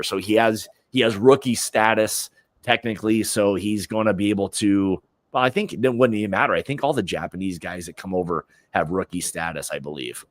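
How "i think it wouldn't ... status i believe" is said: ordinary talk, nothing marked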